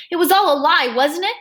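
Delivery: hurt accusation. A narrator's reading. Boy confronts doctor over a lie, accusing. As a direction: confront with pained reproach.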